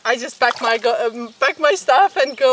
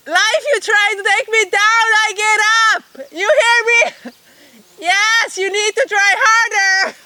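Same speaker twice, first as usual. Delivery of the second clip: playful defiance; 7 semitones higher than usual. Real, not acted. Defiant shout at life.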